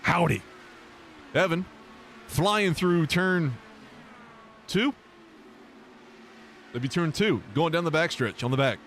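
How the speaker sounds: lightly hammed race-caller delivery; broadcasting voice. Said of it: "Let me get my broadcasting voice on".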